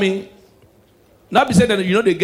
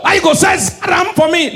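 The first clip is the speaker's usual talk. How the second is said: much higher shouted complaint about "Zaram"; mock angry shout; 10 semitones higher, 3 dB louder than usual. Igbo boy angrily confronts the prophet. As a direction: bark it like an offended customer. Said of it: "Igbo call the prophet".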